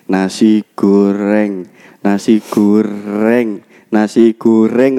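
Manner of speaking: "nasi goreng" slow, careful r; slow practice. Shown as a demonstration. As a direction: say "goreng" slowly, rolling r. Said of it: "disinauni terus".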